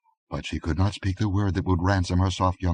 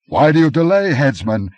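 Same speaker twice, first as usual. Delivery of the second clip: much higher, much louder furious shout; angry demand; 8 semitones higher, 9 dB louder than usual. A narrator's reading. Aquilonian to the headsman, furious shout. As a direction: bark it at the headsman. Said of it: "he exclaimed angrily".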